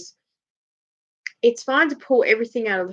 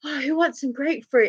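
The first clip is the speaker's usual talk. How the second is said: higher-pitched eager offer; imitating your own excited self; retold excitement; 6 semitones higher than usual. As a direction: offer it round like a treat. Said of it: "And I was so excited".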